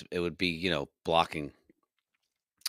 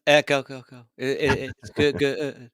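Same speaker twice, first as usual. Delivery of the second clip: raised, stuttering fake echo effect; mock echo; 5 semitones higher, 4 dB louder than usual. Podcaster teasing co-host about echoey room, mock echo. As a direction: repeat words like a bouncing echo. Jokily fakes an echo when asked.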